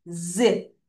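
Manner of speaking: ordinary delivery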